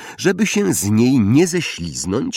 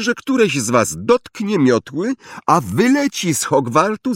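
higher-pitched warning to the class; stern teacher; 6 semitones higher than usual. A narrator's reading. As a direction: lay down the law, pitched up. Teacher warns the whole class.

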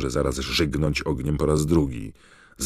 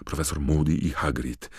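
ordinary delivery

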